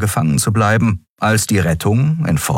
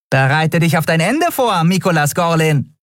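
much higher, mock-ominous threat; taunting Nelen; 10 semitones higher than usual. A narrator's reading. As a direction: announce his doom with relish. Nelen taunts before showing cards.

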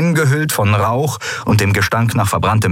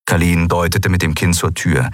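ordinary delivery

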